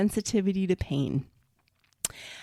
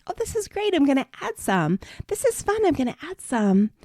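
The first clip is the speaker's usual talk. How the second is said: cheerful excited; 6 semitones higher than usual. Pretend. Voicing a cheerful food attitude.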